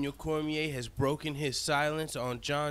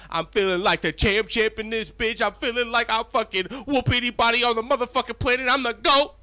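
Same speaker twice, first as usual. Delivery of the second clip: higher, louder victory hype; triumphant yelling; 7 semitones higher, 6 dB louder than usual. Pretend. The fighter bragging to the world, loud and triumphant. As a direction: crow it like you just won the belt. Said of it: "What he wants to say is".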